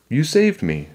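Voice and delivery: put-on high, appreciative girl's voice; grateful little sister. A narrator's reading. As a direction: say it with lifted gratitude. Annie grateful after rescue.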